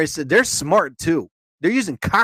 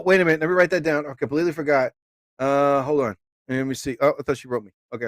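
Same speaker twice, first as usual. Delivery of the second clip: ordinary talk, nothing marked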